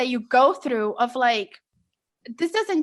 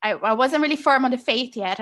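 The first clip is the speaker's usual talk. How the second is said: usual speaking voice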